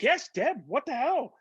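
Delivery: high, astonished retold greeting; delighted surprise. Actor reunited with old friend at gala, delighted surprise. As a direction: blurt it in happy disbelief.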